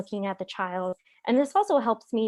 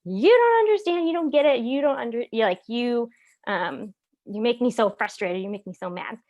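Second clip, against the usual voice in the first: frustrated outburst; 3 semitones higher, 4 dB louder than usual. Retold or imitated. Upset spouse after the worst day, venting frustrated. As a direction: vent at the partner like after a bad day. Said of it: "personally, I'm".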